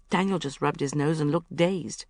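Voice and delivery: ordinary delivery, nothing marked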